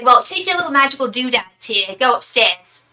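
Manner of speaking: loud, dismissive mock-British order; hammed-up, scornful British accent, loud; rough British accent. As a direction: imitate a British woman sending someone away. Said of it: "She had a really rough accent".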